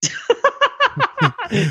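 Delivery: a real laugh bursting out high; high burst of laughter. Real, not acted. Laughing hard at Mark's silence, a high burst of laughter. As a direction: crack up at the silence.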